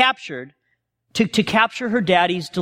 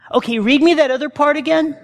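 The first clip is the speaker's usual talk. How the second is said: laughing request; 7 semitones higher than usual. Retold or imitated. Wife asks to hear more.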